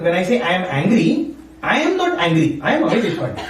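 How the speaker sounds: normal talking voice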